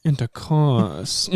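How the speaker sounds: milk every syllable of "Intercourse"; "Intercourse" said at a crawl, last syllable held; very drawn-out word. Shown as a demonstration. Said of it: "you do that lean I was talking about".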